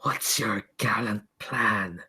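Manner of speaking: slow, hushed mockery from an eerie entity; taunting otherworldly voice. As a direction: taunt them softly, drawing out each word into a near-whisper. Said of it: "the laughter stops and says".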